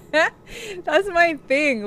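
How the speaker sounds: laughing at herself. Real, not acted. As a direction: giggle through the words. Laughing at her own issue.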